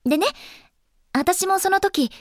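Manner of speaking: ordinary delivery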